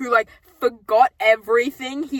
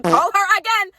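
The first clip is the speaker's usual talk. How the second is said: louder, higher, pleading; urging her to pick up, louder; exasperated call out; 8 semitones higher, 5 dB louder than usual. Retold or imitated. Retelling calls to missing mom, exasperated louder cry. Frustrated repeated phone calls.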